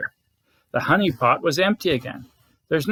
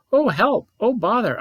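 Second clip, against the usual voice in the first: alarmed cry for help; 7 semitones higher than usual. Acted. Pooh suddenly stuck in hole.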